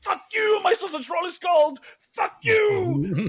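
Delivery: high, hammed-up screaming rant; mock meltdown shout. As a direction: throw a pretend public tantrum. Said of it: "I'm gonna go".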